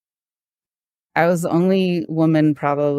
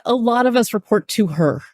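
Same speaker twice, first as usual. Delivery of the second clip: coworker's defence; 6 semitones higher than usual. Retold or imitated. Coworker continues.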